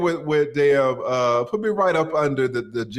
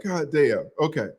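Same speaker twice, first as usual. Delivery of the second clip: normal talking voice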